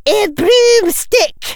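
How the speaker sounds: high, slow, shouted demand. Acted. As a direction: shout across the field. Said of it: "Professor McGonagall shouted".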